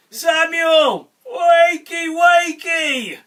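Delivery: high, loud, drawn-out calling; group call-out yell. A narrator's reading. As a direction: call the name, then yell the wake-up cry. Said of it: "we're all gonna yell together".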